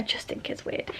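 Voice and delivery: hushed confiding whisper; whispered aside. Real, not acted. Vlogger confiding her dislike of the book, whispered. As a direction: lean in and whisper it.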